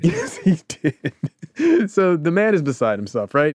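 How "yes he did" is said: laughing through the words, high and slow; laughing, stretched-out agreement. Real, not acted. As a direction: give in to the giggle.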